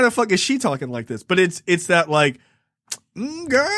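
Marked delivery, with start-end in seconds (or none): 3.2-3.7 high, drawn-out mock sass. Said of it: "head snaking".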